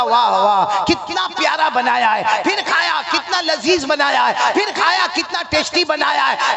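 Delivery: husband hamming up delight at the food; gushing praise. Acted. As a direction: lay the compliments on thick. Husband gushing over biryani.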